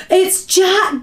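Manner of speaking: loud, high frightened bellow. A narrator's reading. Giant spotting Jack in his nightmare, bellowed. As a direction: bellow the name in alarm. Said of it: "bellowed the flesh lump eater".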